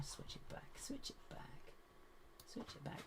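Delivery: whispered muttering to herself. Real, not acted. Streamer fixing the camera, whispering to herself. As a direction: whisper it while fiddling.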